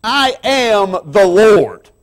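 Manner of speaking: fervent high-pitched sermon peak; preaching at full force. Acted. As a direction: proclaim it like thunder from the pulpit. Preaching climax, rising.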